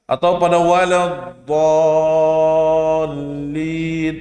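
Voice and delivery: waladdallin drawn out slowly and thin; slow recitation. Shown as a demonstration. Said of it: "nggak boleh dibaca tebal".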